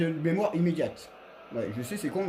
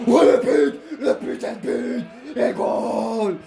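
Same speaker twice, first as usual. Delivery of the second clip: loud, high triumphant yell; goal celebration; 4 semitones higher, 5 dB louder, much slower than usual. Real, not acted. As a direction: roar out the goal in joy.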